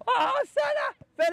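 high, slow, drawn-out cry; excited shout. Real, not acted. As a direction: stretch it out in excitement. Coach reacting to a near goal.

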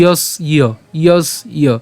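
"যস্য" slowly as yos-iyo; split pronunciation. Shown as a demonstration. Teacher explaining the ya-phala, "যস্য" split yos-iyo. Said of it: "জফলার কারণে উচ্চারণটা হবে হচ্ছে ইয়".

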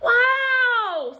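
loud, high drawn-out wow; amazed Douglas. A narrator's reading. Douglas receiving a new hat from Dad, high excited wow. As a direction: burst out high with delight. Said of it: "said Douglas".

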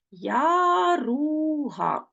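Яруга drawn out slowly as я-ру-га; slow syllables. Shown as a demonstration. Teacher shows pupils Яруга by syllables, slowly. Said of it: "три склади".

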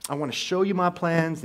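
loud, excited calling out to God, voice climbing; like a kid bursting to show off his plans; eager believer showing God his life plans; eager pleading to God. Retold or imitated. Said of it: "we would tell God".

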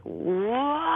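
retold big wail. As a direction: let out a sudden high wail, like bursting into tears. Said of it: "let out this big, huge, like".

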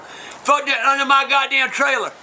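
ordinary talk

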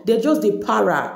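ordinary talk, nothing marked

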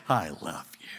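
low, soft, steady reply; soft God voice. Retold or imitated. God answering the broken believer, soft. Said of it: "And God goes".

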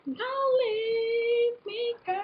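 high, very slow plea; drawn-out whiny plea; playful plea. Real, not acted. Pleading with makeup brush.

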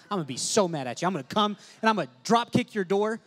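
loud, high playful threat; mock angry threat. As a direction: threaten them with a grin. Joking threat to congregation.

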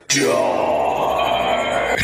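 low, dumb "duh"; dopey duh. Retold or imitated. Disney staff handing out scorching food, low dopey duh. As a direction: play it like a clueless oaf.

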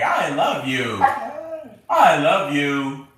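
drawn-out howl of i love you; howly i love you. Shown as a demonstration. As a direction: howl it like a husky. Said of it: "When we say".

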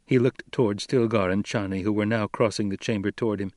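ordinary delivery

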